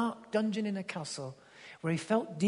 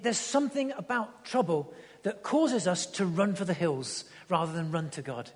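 usual speaking voice

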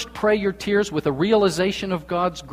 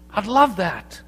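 usual speaking voice

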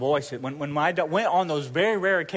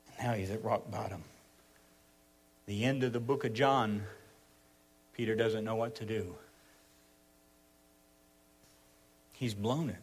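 low, soft, sombre narration; hushed sermon storytelling; 4 semitones lower, 8 dB quieter than usual. A narrator's reading. Preacher telling of the night Jesus was arrested, hushed. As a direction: let the voice sink gravely with the story.

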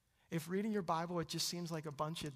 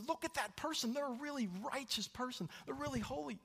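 raised pitch, mock-impressed crowd; put-on admiring exclamation; admiring onlookers; 11 semitones higher than usual. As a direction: lightly act out impressed churchgoers.

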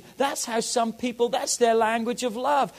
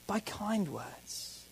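softly; 13 dB quieter, much slower than usual. Shown as a demonstration. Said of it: "saying words kindly".

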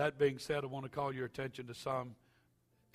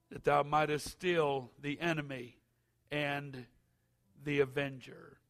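solemn read-aloud scripture; 3 semitones higher, 6 dB louder than usual. Acted. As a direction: read it like Bible verse.

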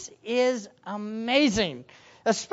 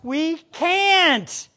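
rising to a very high shout; incredulous protest; 12 semitones higher, 12 dB louder, much slower than usual. Acted. Disciples reacting to an impossible command, incredulous shouting. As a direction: blurt it, then shriek in hammed-up disbelief. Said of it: "they were thinking something like this".

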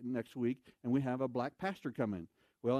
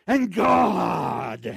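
exclamation; 3 semitones higher, 14 dB louder than usual. Retold or imitated. Covering zipper check comically.

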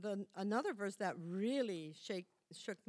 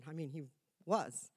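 ordinary talk

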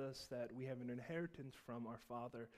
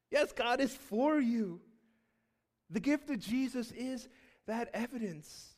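fervent pulpit delivery; preaching at full force; 9 semitones higher, 9 dB louder than usual. Acted. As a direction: preach it out to the whole church. Preaching Christmas message.

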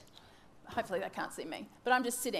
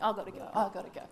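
hurried; 3 semitones lower, much faster than usual. Acted. Biscuits burning, acted panic.

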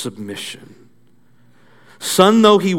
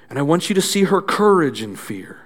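ordinary talk

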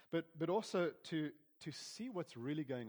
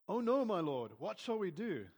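afraid servant; 6 semitones higher, 6 dB louder than usual. Acted. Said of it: "the servant asked".

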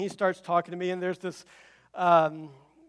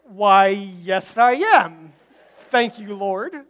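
mock triumphant; 12 dB louder, much slower than usual. Proudly answering the sleeping guy.

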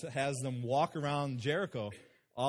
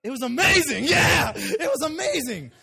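joyful near-tears shout; laughing joy; 9 semitones higher, 10 dB louder than usual. Real, not acted. Preacher overcome by the memory.

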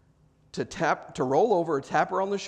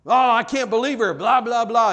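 aggrieved husband grumbling to himself, louder and higher; mock indignant complaint; 5 semitones higher, 6 dB louder than usual. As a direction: act out a mildly overblown grievance. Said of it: "be lying to yourself thinking".

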